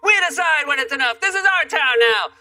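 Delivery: put-on high, defiant bratty kid voice; kids yelling. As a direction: taunt him like a gang leader on a bike. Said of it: "the kids yell back".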